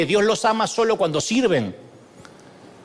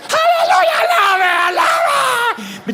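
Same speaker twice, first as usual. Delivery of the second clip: alarido agudo y fuerte de culto exaltado; grito burlón; 4 dB louder, much slower than usual. Retold or imitated. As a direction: imita al que grita en la iglesia. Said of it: "hay gente que le encanta gritar". (aleluya a gritos, burlón y muy agudo)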